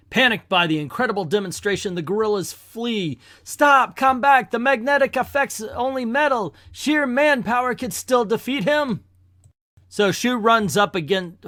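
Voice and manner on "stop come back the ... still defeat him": high-pitched villain's shout; desperate calling out. Acted. Warlord calls to his fleeing guerrillas, shouting desperately. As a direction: call them back in alarm.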